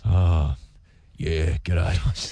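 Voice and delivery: low, blokey "g'day" from deep in the chest; partner's impression of him. Host doing wife's impression of himself: low bloke voice. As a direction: play a laid-back Aussie bloke greeting. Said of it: "Jen's impersonation of you".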